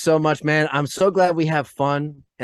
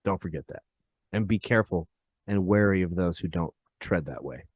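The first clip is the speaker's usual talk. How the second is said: solemn hush; 8 semitones lower, 7 dB quieter than usual. Real, not acted. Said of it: "solemn warning about sacred things".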